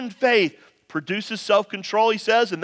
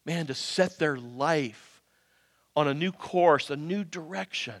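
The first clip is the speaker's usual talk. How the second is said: soft, low, earnest pleading; quiet preaching; 7 semitones lower, 8 dB quieter than usual. Real, not acted. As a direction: drop to a hush and plead. Quiet earnest stretch of sermon.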